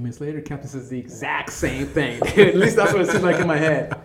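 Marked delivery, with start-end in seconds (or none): 2.2-4.0 loud, high, laughing admission. Real, not acted. Storyteller owning up to exaggerating the captain, laughing loudly. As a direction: burst out laughing as you admit it.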